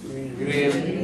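Grian repeated carefully with a falling end; learner repeating. Shown as a demonstration. Learner repeats the Irish word grian. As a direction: echo Grian. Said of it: "the word for sun is grian".

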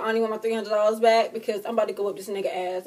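usual speaking voice

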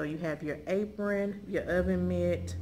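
ordinary talk

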